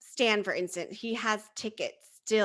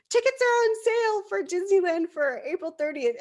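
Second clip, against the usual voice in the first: excited notification; 10 semitones higher, 4 dB louder than usual. Retold or imitated. Dream notification read out.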